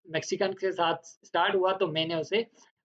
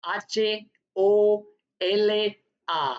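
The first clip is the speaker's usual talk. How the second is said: slow letter-by-letter spelling; dictating the letters slowly; spelled out slowly; much slower than usual. Shown as a demonstration. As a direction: drag out each letter for the class. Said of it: "ओला".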